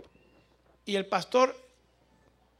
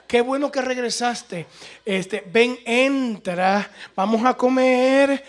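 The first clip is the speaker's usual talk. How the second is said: raised, increasingly loud invitation; effusive welcome, imitated; 5 semitones higher, 7 dB louder, slower than usual. Pastor urging returning preacher to eat, effusive. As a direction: build the hospitality, stretching the last words.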